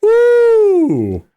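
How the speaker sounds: excited whoop. Real, not acted. Reacting to tense scene.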